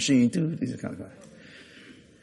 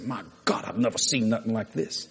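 low, soft awe; hushed amazement; 6 semitones lower, 8 dB quieter than usual. Retold or imitated. Doctor speaks as preacher walks out, low hushed amazement. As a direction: drop the voice right down in disbelief. Voices the awed doctor afterwards.

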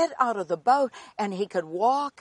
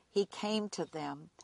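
hushed whisper; 4 semitones lower, 9 dB quieter than usual. Acted. Dramatic reading of Jesus approaching.

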